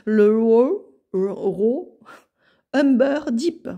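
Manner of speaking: French speaker attempting English words; self-consciously bad English accent; clumsy English accent. Real, not acted. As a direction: have a halting go at an English accent. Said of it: "mon accent anglais, ça fait deux".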